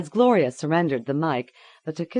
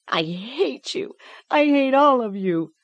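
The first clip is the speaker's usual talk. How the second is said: put-on hateful child; 10 semitones higher, 4 dB louder than usual. A narrator's reading. Tortured girl turns on the adults, rising anger. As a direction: spit the hate out at them all.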